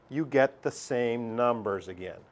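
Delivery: usual speaking voice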